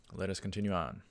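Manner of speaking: ordinary talk, nothing marked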